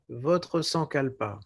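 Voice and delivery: ordinary talk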